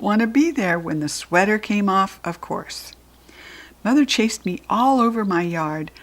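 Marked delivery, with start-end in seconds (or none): none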